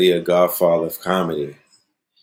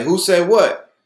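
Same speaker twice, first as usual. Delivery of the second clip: feigned innocence; 9 semitones higher, slower than usual. Retold or imitated. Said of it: "we was like".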